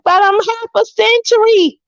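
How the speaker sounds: high-pitched incredulous protest; gleeful protest. Retold or imitated. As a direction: exclaim it way up high, acting out disbelief. Retells protesting her age to waiter.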